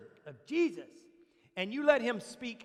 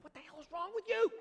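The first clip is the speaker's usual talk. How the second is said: much higher mock exasperation; exasperated cuss; 8 semitones higher than usual. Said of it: "I'd be like".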